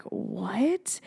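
hushed, rising "what?"; retold stunned reaction. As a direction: say it small and soft, taken aback. Retold stunned reaction to own words.

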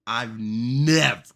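"never" dragged out, slow and loud; stress on never. Acted. Host stresses never before a big claim, slow and loud. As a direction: lean hard on "I've never". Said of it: "And when I say never".

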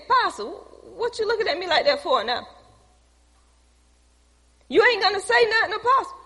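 high, quick teasing voice; mocking [name]. [name] teasing the preacher, high and quick.

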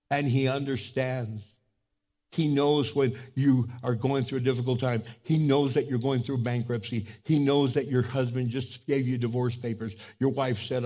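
ordinary delivery

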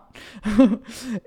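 quick real laugh. Real, not acted. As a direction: laugh it off playfully. Laughing after confident quote.

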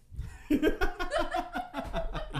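high burst of real laughter; bursting out laughing at herself; loud laughter. Real, not acted. Laughs at her own words.

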